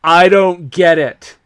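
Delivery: loud, drawn-out exasperated complaint; mock-angry outburst. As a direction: read it as an angry reviewer, loud and slow.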